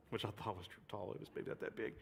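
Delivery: unvoiced confiding aside; whispered aside. Real, not acted. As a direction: drop to a whisper to admit it. Whispered aside on his story.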